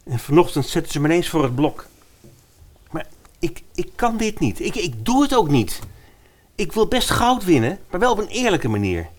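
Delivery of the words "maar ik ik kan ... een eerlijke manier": high, agitated protest; upset refusal. Acted. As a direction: push back, rattled and high. Victor refuses the murder.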